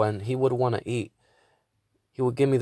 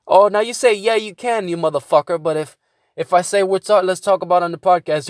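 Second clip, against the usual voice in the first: loud, higher-pitched indignant comeback; retold angry retort; 6 semitones higher, 7 dB louder than usual. Calling out a friend over the podcast, heated retort. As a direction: argue back heatedly, raised voice.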